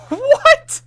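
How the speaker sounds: shocked high what, then disbelief; incredulous. Real, not acted. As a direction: react in stunned disbelief. Shocked reaction to tarantula remark.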